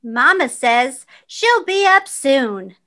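plain reading voice

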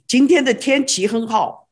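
今天的天气很好 in quick Sichuan dialect, fast. Shown as a demonstration. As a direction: rattle it off Sichuan style. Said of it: "But Sichuan ... and we say".

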